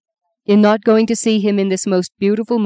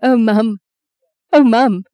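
higher, anguished appeal to mum; distressed; 5 semitones higher, 5 dB louder than usual. Acted. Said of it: "sensing Justine's distress".

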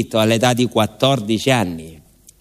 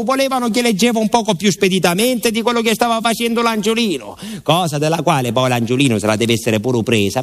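usual speaking voice

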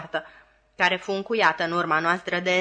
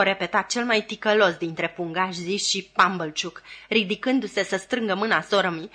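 ordinary delivery

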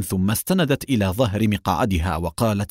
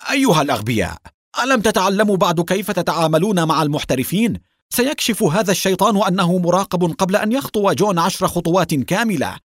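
furious, much higher and louder yell at fools; angry shout; 9 semitones higher, 3 dB louder than usual. Acted. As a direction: scold the idiots at full blast. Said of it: "وصاح بغضب". (صراخ غاضب بصوت عالٍ)

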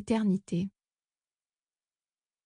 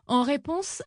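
usual speaking voice

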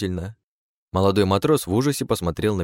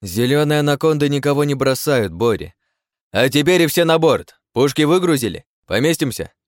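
commanding reply; 7 semitones higher, 5 dB louder than usual. Acted. Leader answers, orders all aboard.

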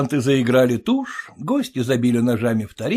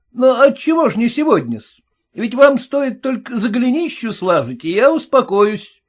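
a high, pinched voice, louder, needling the father-in-law; mocking groom; 10 semitones higher, 5 dB louder than usual. Groom taunting his stingy father-in-law, high and mocking. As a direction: jeer at him in a sing-song. Groom demanding money now.

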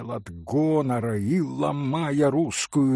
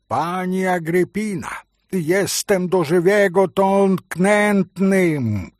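slow, louder pompous Polish outcry; Polish exclamation; 5 semitones higher, 5 dB louder than usual. Acted. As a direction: declaim it theatrically like a wounded noble. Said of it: "воскликнул было он". (театральное польское восклицание, медленно и громко)

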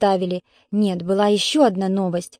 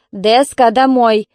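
loud, sharp order to a dog; shouted command; 2 semitones higher, 6 dB louder, slower than usual. A narrator's reading. Woman orders her dog home, shouting. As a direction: call the dog home loudly. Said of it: "крикнула женщина".

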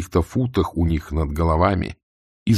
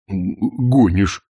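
slow, stammered, disbelieving; stammering disbelief; much slower than usual. A narrator's reading. Corporal stammers in disbelief at the news. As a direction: stumble over it in shock.

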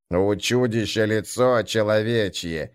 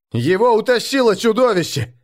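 higher, louder panicked cry; urgent panting report; 10 semitones higher, 4 dB louder than usual. Acted. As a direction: blurt it out, out of breath. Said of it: "задыхаясь, выпалил он".

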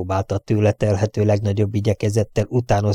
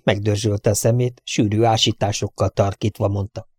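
plain reading voice

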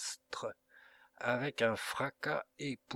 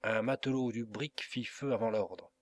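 usual speaking voice

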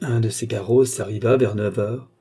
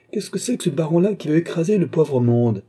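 raised, threatening heckle; higher-pitched angry cry, moderately loud; menacing shout; 6 semitones higher, 3 dB louder than usual. A narrator's reading. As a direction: challenge him from the crowd. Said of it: "crièrent quelques voix menaçantes".

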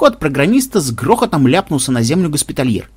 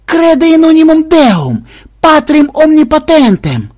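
high, loud, slow drawn-out Latin prayer; manic incantation; 13 semitones higher, 6 dB louder than usual. A narrator's reading. Enraged programmer recites the creed while banging his head, manic incantation. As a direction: belt out the creed like a madman, stretching every word. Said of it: "приговаривая".

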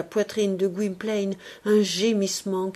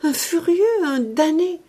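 raised, pleading lover begging her not to die; frantic young man begging at a deathbed; desperate pleading; 7 semitones higher, 4 dB louder than usual. Acted. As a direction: beg her with everything you have. Gwynplaine pleads at dying Dea.

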